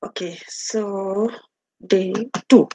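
soft whispered aside to herself. Real, not acted. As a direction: breathe it under your breath, slowly. Aside while opening slides.

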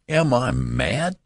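high, drawn-out outraged question; thundered. A narrator's reading. Horrified father seeing the old man, mildly thundering. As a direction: draw it out slowly in rising, understated outrage. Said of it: "thundered Mister Button".

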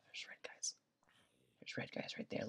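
hushed unvoiced whisper. Real, not acted. Gamer whispering as enemies appear nearby. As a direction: whisper a warning to viewers. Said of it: "I don't know why I'm whispering".

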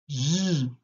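J sustained as "zhhh"; isolated consonant sound. Shown as a demonstration. Teacher giving first sound of girafe, sustained J. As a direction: sound out J as one long buzz. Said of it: "On entend le son".

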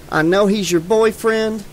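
ordinary delivery, nothing marked

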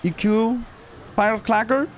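high, put-on command voice; mock-heroic high summons; playful battle call. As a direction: send your creature into battle, hammed up. Playfully commands game card like creature trainer.

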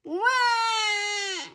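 put-on high, loud baby wail; loud crying wah. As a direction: wail like a toddler. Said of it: "cries George".

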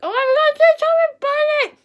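high, loud excited squeal; excited shout. Acted. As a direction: shout it out with glee. Announcing the game excitedly.

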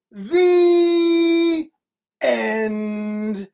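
loud, high held word, then drop; grand ending. A narrator's reading. Storyteller closing the book, drawn-out announcement. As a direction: stretch out the closing words.

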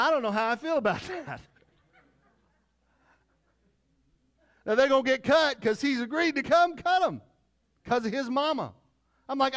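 loud, high, building preacher's cry; rising to a loud mock-outraged peak; climbing into a full-voiced comic sermon shout; preaching at full force. Pastor's story rises to a loud pitch.

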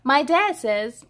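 put-on bright, lively read-aloud for kids; cheerful storybook reading; story narration. A narrator's reading. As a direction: read it like a picture book to little ones. Reads picture book aloud.

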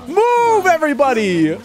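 high, loud command; sharp high move; urgent yell. Real, not acted. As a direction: shout the group along. Urging friends away.

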